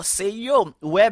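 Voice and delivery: ordinary talk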